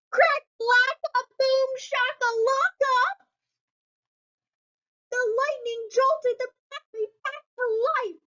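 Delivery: high, excited sound effects; dramatic storm effects. Acted. Reading the storm scene to kids, high excited booms. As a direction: burst out the lightning boom.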